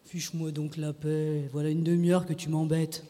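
low-voiced, weary irritation; irritated husband. A narrator's reading. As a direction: grumble it low to be left alone. Husband brushes wife off.

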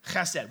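"Chesed" with a rasped guttural ch, /xæsɛd/; "Chesed" spoken as in Hebrew, guttural first sound; Hebrew word. Shown as a demonstration. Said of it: "you still with me".

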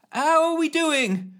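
high, slow yell back. A narrator's reading. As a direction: call it back over the noise. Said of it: "yelled back Ellen".

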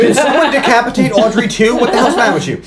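high, loud, laughing outrage; hammed-up indignant shout; mock outrage. Mocking someone's outburst at the gatekeeper, high, loud and laughing.

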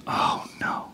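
hushed, breathy oh no; whispered worry. Retold or imitated. Teacher whispering in alarm at window.

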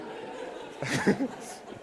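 airy high giggle; high breathy laugh. Real, not acted. Preacher giggling at himself, high and airy. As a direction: let the laugh escape.

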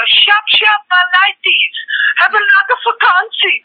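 high mock local greeting to kids; hammed-up call to kids. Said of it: "So you'll pull up and go".

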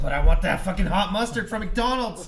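loud excited craving rant; shouting. Real, not acted. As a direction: belt it out, half laughing.

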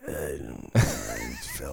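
mocking his co-host's groggy drawl; mock drunk co-host. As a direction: parody how his hungover partner said it. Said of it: "you were like".

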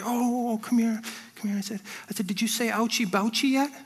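mock parental comforting. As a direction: coo it like a soothing parent. Parent comforting a hurt crying child.

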